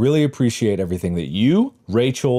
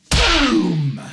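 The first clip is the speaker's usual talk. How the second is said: high, drawn-out falling boom; high vocal explosion effect; imitated boom; 10 semitones higher than usual. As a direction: blast out a cartoon explosion. Sound effects while waiting.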